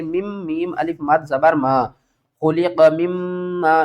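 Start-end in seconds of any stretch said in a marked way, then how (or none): 2.4-3.8 slow, joining the two meems in مما; slow recited خلق مما with one long meem; merged double meem. Shown as a demonstration. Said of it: "کس طرح؟".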